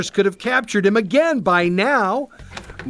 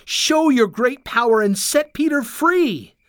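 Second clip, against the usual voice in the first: higher-pitched fervent plea; crying out; 7 semitones higher than usual. A narrator's reading. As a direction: cry out a prayer for rescue. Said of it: "they cried".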